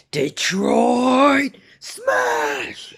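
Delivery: very high, drawn-out attack shout; All Might, battle cry. Acted. Hero strikes the villain to save the kid, battle cry. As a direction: stretch out each word as a superhero's finishing-move yell.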